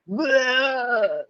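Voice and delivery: high sharp intake of breath; shocked gasp. Retold or imitated. Coworker hearing the orgasm line, high shocked gasp. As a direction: gasp in mock horror, pitched high. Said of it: "She's like".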